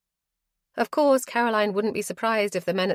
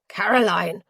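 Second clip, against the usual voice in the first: louder alarmed cry; calling out; 6 dB louder than usual. Acted. Ro catching wounded Caroline, crying out. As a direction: cry her name. Ro sees her shot.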